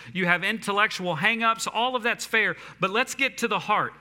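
passionate urgent appeal; preaching. Real, not acted. Impassioned appeal to listeners.

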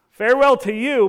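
see them out with a shout; loud send-off. Retold or imitated. Jesus sending the wailers off, loud.

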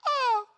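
high loud hammed-up yelp; mock scream of pain. Retelling the ball bending his foot back, high mock scream. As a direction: shriek as the foot bends back. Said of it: "And my foot goes".

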